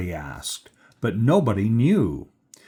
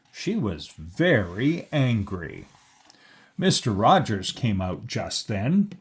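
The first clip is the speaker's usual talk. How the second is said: ordinary delivery, nothing marked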